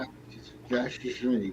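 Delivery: put-on slurring drunk words; town drunk. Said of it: "He's slurring his words".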